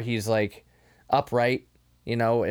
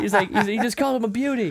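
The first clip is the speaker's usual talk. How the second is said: ordinary delivery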